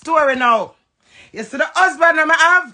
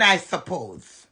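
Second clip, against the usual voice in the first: ordinary talk, nothing marked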